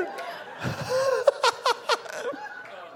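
shrieking high laugh; high cackling laugh. Real, not acted. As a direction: burst out in a squealing laugh. Big laugh after story.

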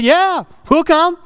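high, slow, drawn-out yes; hammed-up happy acceptance; mock eager yes. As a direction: sing out the yes like an eager guest. Eagerly accepting free food offer.

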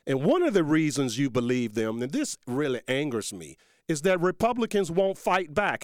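fired-up preacher against the Democrat Party; heated rant. Real, not acted. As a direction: build into an angry political tirade. Political rant building.

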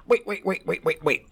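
high, heated mock rant; hammed-up furious old politician; imitating angry Biden. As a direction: jab the words like a finger in his face. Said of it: "Biden told the man, pointing his finger".

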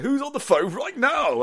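high-pitched, drawn-out presenter patter; hammed-up slow "who's calling"; mock radio host. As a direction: imitate a zany phone-in host. Imitating the radio host taking calls.

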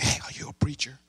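soft unvoiced "are you a preacher"; whispered question. Inmate whispers to the preacher asking if he preaches.

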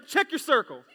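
put-on loud and firm, like disciplining a child; forceful command. Shown as a demonstration. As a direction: scold your neighbour with it. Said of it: "Do it again".

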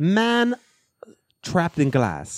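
high-pitched fake Polish accent; mock Polish accent. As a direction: perform the Polish man impression on request. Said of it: "Do it again".

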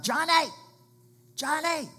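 high, very loud shout of a name; yelling dad. Retold or imitated. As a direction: yell out like a dad calling his child back from danger. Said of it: "the dad yells out".

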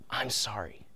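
hushed, sincere apology, almost whispered; soft, breath-light and truly sorry; heartfelt apology. Retold or imitated. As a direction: imitate a child's heartfelt apology, very softly. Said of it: "said a heartfelt".

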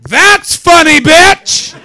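high, loud shouted punchline; hammed-up yell as if throwing a punch; very high loud fantasy shout; mock punch yell. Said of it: "wanted to rear back and just go".

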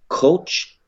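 incredulous repeat. Retold or imitated. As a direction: throw back "coach" in disbelief, way up high. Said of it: "And he said".

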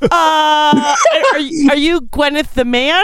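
high-pitched mock disbelief; baffled reaction. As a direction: stammer it high, as if totally thrown. Acts out her baffled reaction.

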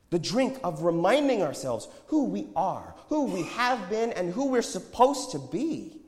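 high, loud, urgent exhortation; impassioned prophet. A narrator's reading. Prophet warns the shoppers about illusions, impassioned. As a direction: preach at them with rising fervour.

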